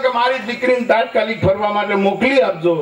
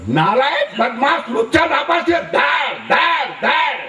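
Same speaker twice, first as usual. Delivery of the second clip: shouting, higher mock police father scolding; angry scolding; 5 semitones higher than usual. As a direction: bark insults at the failed son. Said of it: "એને તરત પેલાને ખકડાવ્યો". (ગુસ્સામાં બૂમ પાડીને ઠપકો)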